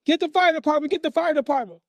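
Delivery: high-pitched panicked holler; mother screaming, hollering. Retold or imitated. Mother as the mattress burns, screaming and hollering. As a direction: imitate a frantic mom calling for help. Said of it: "screaming, hollering".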